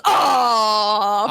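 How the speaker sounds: long, drawn-out mock groan; crowd's groan. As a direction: groan like a crowd hearing a terrible pun. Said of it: "the crowd just goes".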